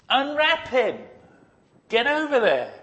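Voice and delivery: impatient command. Acted. Jesus pushing the stunned crowd to act, impatient. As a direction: hurry the frozen crowd along.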